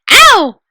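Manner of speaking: high loud yelp of pain; sharp startled ow; sudden ow. Acted. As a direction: cry out as if yanked. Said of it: "made a noise like".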